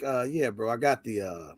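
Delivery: low, soft, hesitant polite request; nicest way. Retold or imitated. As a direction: imitate a friend asking ever so gently. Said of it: "says it to me in the nicest way".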